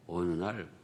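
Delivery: ordinary delivery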